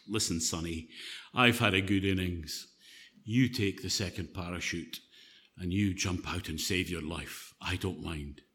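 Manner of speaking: put-on a kindly old pilot giving up his chute. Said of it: "older guy, turns to the Boy Scout and he says".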